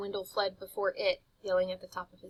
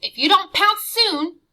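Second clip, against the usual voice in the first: hissed urging; 10 semitones higher, 15 dB louder than usual. Acted. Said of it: "Jive hissed".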